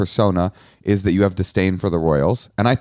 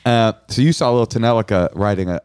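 ordinary talk, nothing marked

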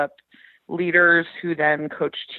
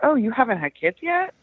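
higher mock of a prying stranger; lightly voiced nosy acquaintance; nosy small talker; 6 semitones higher than usual. Guest quoting a stranger's nosy question, lightly mocking. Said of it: "making small talk says".

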